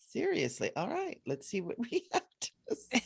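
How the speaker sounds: a laughing, disbelieving quip to her host; mock skeptical aside. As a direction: play your own doubt back with a chuckle. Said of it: "I'm like".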